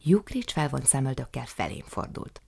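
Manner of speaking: ordinary delivery, nothing marked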